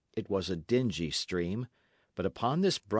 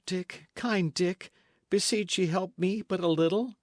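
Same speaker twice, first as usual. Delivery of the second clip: desperate plea; 9 semitones higher than usual. Acted. Fugitive begs Dick for help.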